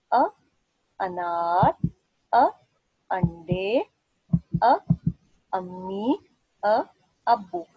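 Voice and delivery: slow, loud call-and-repeat of "अ" words; recitation drill. Shown as a demonstration. Teacher leading pupils in a loud recitation drill. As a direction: chant each word clearly for the children to echo. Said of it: "आप लोग मेरे साथ साथ पढ़ें".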